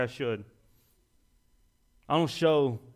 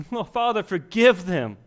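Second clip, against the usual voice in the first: dying plea; 5 semitones higher, 6 dB louder than usual. Acted. Jesus on the cross, dying.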